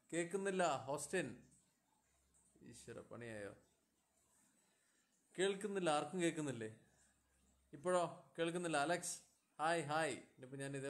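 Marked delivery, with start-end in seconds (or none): 0.1-1.3 high, projected voice; loud call. Real, not acted. Streamer answering a viewer who can't hear, calling loudly. Calling out over failing audio. 5.4-10.1 loud, raised checking voice; calling out loudly. Real, not acted. Streamer checking whether anyone hears, nearly shouting. Calling out to viewers who cannot hear.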